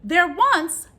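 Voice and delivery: lively rhyming picture book read; reading to children. A narrator's reading. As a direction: bounce the rhymes for kids.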